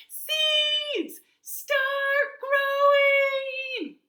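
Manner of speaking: drawn-out, high, top-of-the-lungs yell; full-lungs shout. A narrator's reading. Toad shouting at seeds that won't grow, full-lungs shout. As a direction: holler it high, stretching every word. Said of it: "at the top of his lungs".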